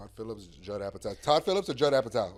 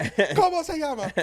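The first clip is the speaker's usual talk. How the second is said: much higher, slow, put-on Spanish voice; high-pitched silly voice; 5 dB louder than usual. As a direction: ask it in a squeaky silly voice. Quoting movie character voice.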